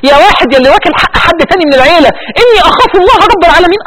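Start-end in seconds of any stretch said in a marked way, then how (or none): 2.4-3.8 tearful. Real, not acted. Preacher repeats verse, voice breaking.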